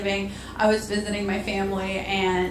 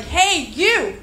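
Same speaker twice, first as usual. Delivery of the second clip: schoolyard taunt; 5 semitones higher than usual. Retold or imitated. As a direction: tease like a bratty schoolkid. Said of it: "They'd be like".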